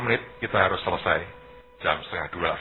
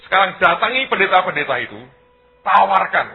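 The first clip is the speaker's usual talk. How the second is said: loud, higher, emphatic order; commanding instruction; 7 semitones higher, 8 dB louder than usual. Retold or imitated. Leader sends team to offer help to pastors, loud commanding instruction. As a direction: dispatch the team with a raised voice.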